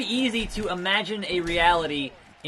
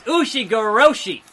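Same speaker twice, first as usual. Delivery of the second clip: loud, high move name; startled shout of the move name; loud callout; 6 semitones higher, 8 dB louder than usual. Real, not acted. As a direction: call out the move in shock. Calling out opponent move.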